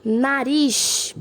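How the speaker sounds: Nariz loud, final RIZ held; stress on RIZ in Nariz. Shown as a demonstration. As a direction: lean hard on the last syllable of Nariz. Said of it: "Ris é a última sílaba". (Nariz com a última sílaba forte)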